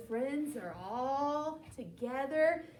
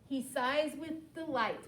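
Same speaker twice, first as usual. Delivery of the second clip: ordinary delivery, nothing marked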